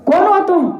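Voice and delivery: high-pitched acted demand; mock-stern royal questioning; stern challenge. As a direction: challenge an intruder like a king. King challenges the intruder Kalyug.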